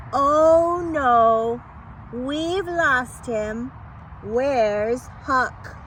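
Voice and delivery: ordinary delivery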